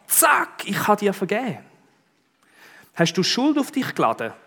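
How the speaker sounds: lightly voiced, high and breezy reassurance; a hint of a casual, cheerful God. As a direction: toss off the answer as if it's easy. Voicing God's casual answer.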